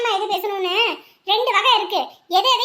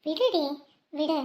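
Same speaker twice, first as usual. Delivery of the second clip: soft consoling; 6 semitones lower, 8 dB quieter than usual. Acted. Friend consoling a heartbroken girl.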